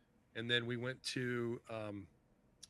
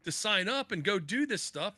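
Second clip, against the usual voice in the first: surprised protest; 8 semitones higher, 5 dB louder than usual. Retold or imitated. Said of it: "I said".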